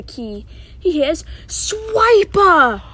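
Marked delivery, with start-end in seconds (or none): 1.5-2.8 high, loud, drawn-out warning; alarmed cry. Acted. Nora hearing Swiper near the key, alarmed cry. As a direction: cry the name out in storybook alarm.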